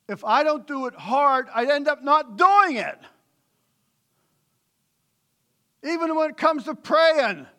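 loud, high, driving preaching; fervent preaching. Real, not acted. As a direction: press the point with full force. Preaching at full force.

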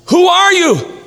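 high-pitched acted shout; calling up. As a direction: call up from the cliff in a high voice. Dangling man calls up to voice.

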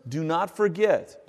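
"forget" slow and stretched, falling; slow down on "do not forget"; emphasis on forget. Shown as a demonstration. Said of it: "Notice the emphasis of remember".

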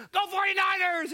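loud, high rival fan's cheer; cheering shout. Retold or imitated. Pastor shouting at a Seahawks kid, loud and high. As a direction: yell it like a fan taunting a rival fan. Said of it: "I come out of my office and go".

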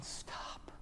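hushed, unvoiced whisper as God; whispered plea. God to people hiding their sin, whispered plea. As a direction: whisper it to halt them. God quietly halting those who hide.

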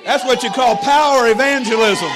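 very loud, high-pitched proclamation; triumphant preaching shout. Real, not acted. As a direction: shout the punchline over a cheering congregation. Preacher's climax to cheering congregation.

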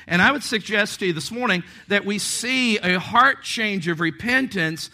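loud, high, driving sermon voice; forceful preaching. Acted.

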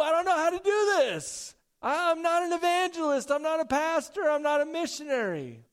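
high, loud, whiny put-on voice; hammed-up high fretful voice, racing a bit in the middle; mock unsure churchgoer. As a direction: play the nervous believer who wants to help but feels unqualified. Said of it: "Some of you are like".

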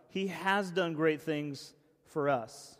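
slow line with "has" drawn out and stressed; "has" as the emphatic peak, then falling. Acted. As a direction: affirm it slowly, leaning hard on "has". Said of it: "Emphasis mine".

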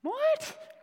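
a high teasing what; mock puzzled what. Real, not acted. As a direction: squeak it like you can't believe it. Prompting congregation.

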